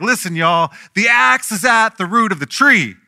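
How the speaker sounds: John the Baptist at full force, high and loud; fired-up preaching. Retold or imitated. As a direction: bellow it as a fiery prophet warning the crowd. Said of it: "he is fired up".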